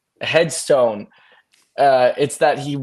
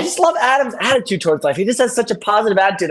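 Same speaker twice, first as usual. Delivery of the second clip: mock gushing, higher and quicker; gushing friend impression; 6 semitones higher, faster than usual. Retelling a friend praising Adam's attitude, gushing. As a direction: imitate a friend gushing admiringly. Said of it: "And she goes".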